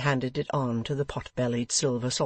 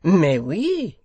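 louder French exclamation; French accent; 4 semitones higher, 9 dB louder than usual. A narrator's reading. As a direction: answer brightly in French. French guest answering.

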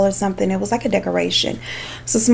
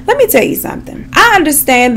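fired-up warning; 7 semitones higher, 4 dB louder, faster than usual. Real, not acted. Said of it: "launching into a lecture".

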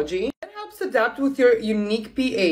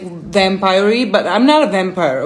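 ordinary talk

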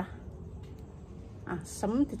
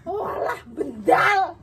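dismayed shout; 7 semitones higher, 4 dB louder, slower than usual. Pretend. Groan at a bent shot.

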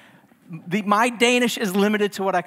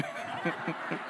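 soft breathy laugh; 4 semitones lower, 13 dB quieter than usual. Real, not acted. Laughs after the reveal.

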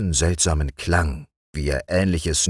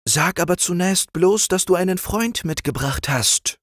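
put-on raised, higher young king; king appointing his friend the frog chamberlain, higher voice; young king's voice. A narrator's reading. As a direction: play the young king granting an honour. King's dialogue.

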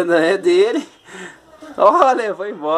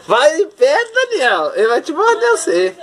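pitched way up, calling a warning; high urgent warning; 11 semitones higher than usual. Real, not acted. Mother warns Daniel away from growling panda, high urgent call. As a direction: shout high so Daniel backs off.

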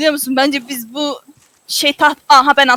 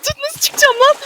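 hanging on for dear life; 12 semitones higher than usual. Real, not acted. Falling off boat, begging help.

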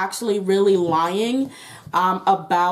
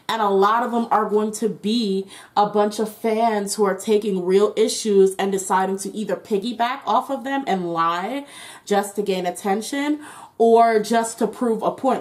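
ordinary delivery

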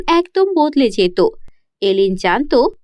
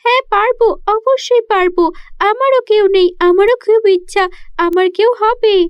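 put-on high, bright-eyed child saying yes; a lonely girl gladly accepting; eager little girl; 7 semitones higher than usual. (উৎসুক ছোট্ট মেয়ের উঁচু গলা)

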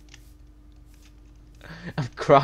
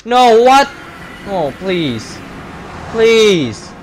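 upset protest; 5 semitones higher, 4 dB louder than usual. Real, not acted. Protesting the griefing.

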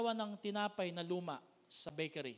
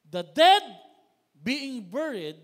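much louder, higher burst; loud proclamation; 4 semitones higher, 17 dB louder, slower than usual. Acted. Preacher voicing the Lord about the dead, loud. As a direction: cry it out.

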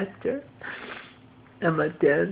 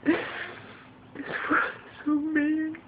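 slow and higher, wailing; slow high lament; 8 semitones higher than usual. Real, not acted. Lamenting to viewers. (slow, high self-pitying wail)

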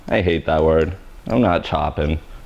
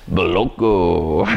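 Moloko in a put-on Russian accent, slow; 2 semitones lower, much slower than usual. Shown as a demonstration. A stylist tries her Russian accent on Moloko, slow. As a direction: roll out Moloko like a bad Russian impression.

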